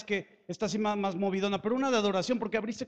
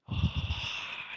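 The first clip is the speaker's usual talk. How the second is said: long, airy "ah"; deep savoring breath; 3 dB quieter than usual. Acted. Papa leaning out to smell the offering, long savoring breath. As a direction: draw a big audible breath. God leans in to smell worship.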